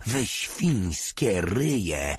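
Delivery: drawn-out angry insult; yelling. A narrator's reading. Lieutenant cursing his soldiers, slow mock yell. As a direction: stretch the insult out in anger. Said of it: "wrzasnął Weber".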